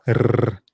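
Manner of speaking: R as a strong tongue trill; trilled R, held briefly; rolled R. Shown as a demonstration. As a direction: roll the tongue hard on R. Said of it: "Can you roll your tongue?".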